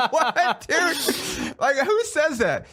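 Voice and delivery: high, laughing disbelief; incredulous. Retold or imitated. Recalling his reaction to a celebrity's line, incredulous.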